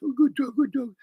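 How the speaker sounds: put-on dog baby talk. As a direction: coo at the dog. Praising a dog.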